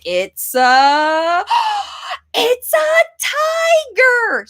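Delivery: high gasping delight, slow reveal; thrilled reveal for a toddler; excited gasp. Shown as a demonstration. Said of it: "Show them how you do it".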